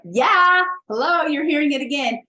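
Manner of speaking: loud, high insistent call. Pretend. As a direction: shout it like the universe nudging you. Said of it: "your higher self is like".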